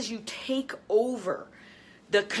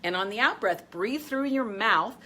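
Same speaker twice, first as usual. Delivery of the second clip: guided meditation. Acted. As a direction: ease them into calm breaths. Leads a breathing exercise.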